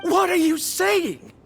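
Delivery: incredulous. Acted. Incredulous at being told to turn back.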